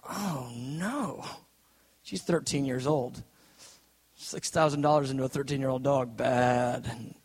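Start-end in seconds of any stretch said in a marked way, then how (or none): 0.1-1.2 soft, drawn-out retold dismay; dismayed groan. Husband hearing of his dog's surgery bill, retold dismay. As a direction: let the quiet "no" swoop in mild mock dread.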